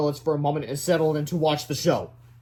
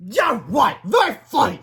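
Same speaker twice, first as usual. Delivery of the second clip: sarcastic shout; 7 semitones higher, 7 dB louder than usual. Acted. Sarcastic angry principal.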